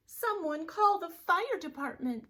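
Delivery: a mama bear calling for help; loud high urgent call; urgent mother bear. Acted. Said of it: "said Missus Blair".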